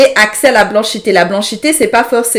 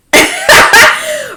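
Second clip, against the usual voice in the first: loud high-pitched laughter; 3 dB louder than usual. Real, not acted. Streamer cracking up at the chat, high laugh.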